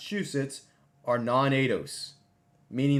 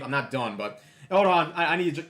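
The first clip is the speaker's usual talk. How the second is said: normal talking voice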